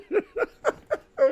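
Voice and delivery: real laugh; high laugh. Real, not acted.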